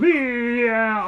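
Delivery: hammed-up cheer of a game character; imitating the character's yell. Gamer mimicking a stood-still character, mock yell. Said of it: "he's going".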